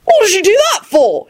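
high indignant protest; yell. A narrator's reading. Arthur protests being knocked down, yelling. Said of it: "he yelled".

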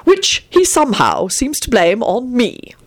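peeved, deliberate grumbling; sour. A narrator's reading. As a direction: complain sourly, drawing it out. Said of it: "Arthur continued sourly".

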